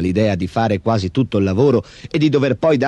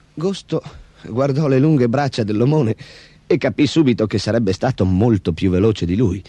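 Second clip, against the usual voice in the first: ordinary delivery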